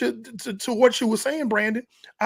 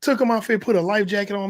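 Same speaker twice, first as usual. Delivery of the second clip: ordinary talk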